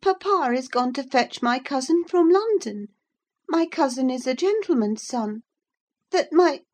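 put-on high, loud, haughty child's protest; upset girl, protesting. Girl upset at having such a cousin, high and protesting. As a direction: insist in a distressed high voice.

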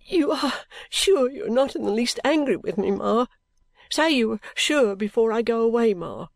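lightly voiced tearful young bride; anxious daughter. A narrator's reading. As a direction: plead for your mother's reassurance.

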